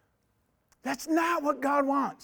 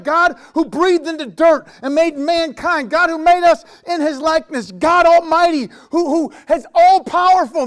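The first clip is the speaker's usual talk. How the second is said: building to a loud, high, fervent cry; preaching at full force; 5 semitones higher, 8 dB louder than usual. Acted. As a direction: thunder it out like a revival preacher.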